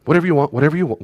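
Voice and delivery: put-on high, hurried child giving in; placating child. A child appeasing an angry parent, high and eager. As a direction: give in fast to appease, pitched high.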